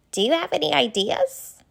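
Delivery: put-on a kind, worried young giraffe asking questions; Ginger the giraffe. Ginger asks Leo.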